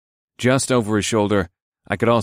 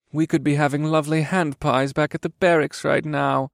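higher-pitched, whiny soldier pout; pouting young elf; 6 semitones higher than usual. A narrator's reading. Young elf soldier missing barracks pies, pouting. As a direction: complain like a sulky young elf.

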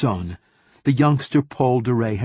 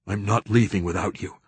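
ordinary delivery

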